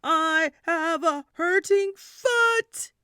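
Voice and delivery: shouting to the neighbour far ahead. A narrator's reading. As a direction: call out from far behind. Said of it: "so I had to shout".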